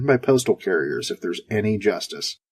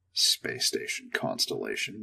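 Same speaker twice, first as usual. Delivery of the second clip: whispered, slow, mocking the name; sarcastic whispered repeat; 12 semitones lower, 13 dB quieter than usual. A narrator's reading. Astronaut scoffing at the station's silly name, whispered. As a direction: breathe the name out in scorn. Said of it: "What idiot came up with that name?".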